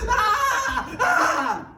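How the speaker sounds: very high, loud screams; repeated high screams of pain; screaming. Acted. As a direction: shriek in mock agony. Humpty back on ground screaming.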